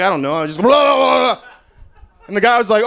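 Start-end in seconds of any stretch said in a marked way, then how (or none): 0.6-1.4 high, slow, laughing blah noises; mock slurping blah. Comic imitating the sound of oral sex, with high, slow, laughing blahs. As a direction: imitate going to town with silly blahs. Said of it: "that's what it sounds like".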